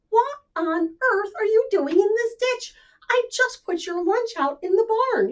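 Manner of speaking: high, loud astonished farmer; a farmer finding a pig in a ditch; surprised farmer. Acted. Farmer to pig, high and astonished.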